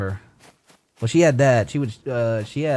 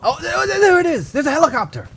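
high-pitched excited cry; excited discovery; 3 dB louder than usual. Real, not acted. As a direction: blurt it out on spotting it. Spots the helicopter.

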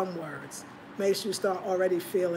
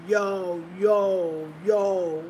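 imitating New York yo; 4 dB louder, much slower than usual. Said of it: "He be like, like this".

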